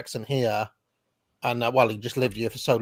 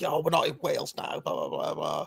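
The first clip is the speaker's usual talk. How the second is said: mock posh London wife grumbling; posh missus; 6 dB quieter than usual. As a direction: imitate a nagging posh wife, tailing into blah-blah. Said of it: "the missus is posh".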